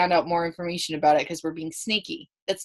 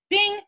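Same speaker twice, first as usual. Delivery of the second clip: bing; 12 semitones higher than usual. Acted. Idea bell lightbulb moment.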